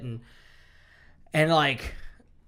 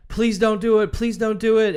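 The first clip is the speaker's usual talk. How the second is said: a higher, louder mock plea; imitating begging; 7 semitones higher, 7 dB louder than usual. Rick begging Negan to spare his son, a mock plea. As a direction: beg him in a hammed-up voice.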